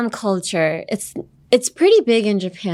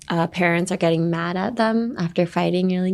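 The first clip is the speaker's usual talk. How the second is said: ordinary delivery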